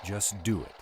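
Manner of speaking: firm insistence. Acted. Insisting under pressure.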